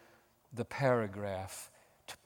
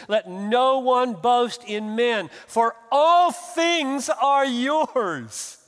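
preaching climax; 12 semitones higher, 13 dB louder, much slower than usual. Acted. Climax of the sermon.

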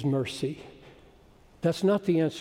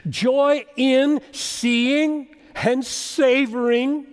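high, loud exultant proclamation; impassioned preaching; 8 semitones higher, 8 dB louder, slower than usual. Real, not acted. Preacher declaring joy the root, raised emphatic voice. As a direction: preach it out, stretching the key words.

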